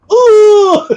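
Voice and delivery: loud high whooping cry of laughter; whooping at his own story. Real, not acted. Laugh fit peaks.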